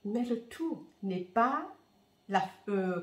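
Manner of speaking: usual speaking voice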